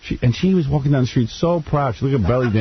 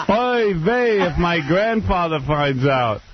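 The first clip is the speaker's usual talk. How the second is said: high, slow oy-vey whine; hammed-up Yiddish accent, high and slow; mock Yiddish accent; 6 semitones higher, slower than usual.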